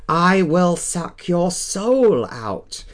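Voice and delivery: high, slow hammy vampire shriek; vampire scream. Acted. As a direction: wail it like a B-movie vampire. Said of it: "he screamed".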